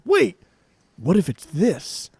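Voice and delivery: mock House's big realization. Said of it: "And then House says".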